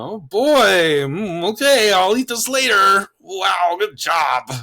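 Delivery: high, loud, fake-cheerful praise while forcing food down; sarcastic "good job" with a strained show of delight; mock-enthusiastic thanks to the kids for their cooking. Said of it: "You can tell a little sarcasm's there".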